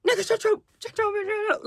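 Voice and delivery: high mocking imitation of a slow-talking man; imitating Don. Mimicking Don telling her to shut up, high and mocking. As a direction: mimic him sounding slow and dumb. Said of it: "he sounds so slow".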